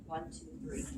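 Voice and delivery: soft whispered numbers; whispering. Shown as a demonstration. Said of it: "I'm gonna whisper some numbers".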